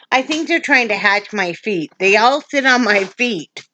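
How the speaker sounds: ordinary talk, nothing marked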